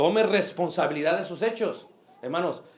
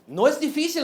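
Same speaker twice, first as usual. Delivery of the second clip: higher and louder denial; preacher shouting down the excuse; forceful rebuttal; 9 semitones higher, 7 dB louder than usual. Shown as a demonstration. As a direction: slam down the excuse.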